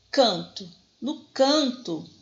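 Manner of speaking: "Canto" slowed, weight on CAN; teacher showing the stressed CAN in "canto"; stress on first syllable. Shown as a demonstration. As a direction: lean on the CAN of "canto", drawn out. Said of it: "a sílaba tônica é o can".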